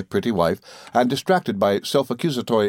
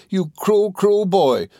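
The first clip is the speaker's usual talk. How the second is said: very high, petulant wife's cry; hurt reproach; 10 semitones higher, 4 dB louder, much slower than usual. A narrator's reading. Dora turning away from her husband, high and reproachful. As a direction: lament it, pitched right up.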